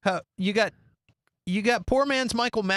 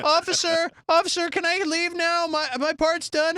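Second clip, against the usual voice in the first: whiny actor voice; 10 semitones higher than usual. Retold or imitated. Actor asking police to leave, higher whiny plea. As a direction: beg the cop to let him go. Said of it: "he's like".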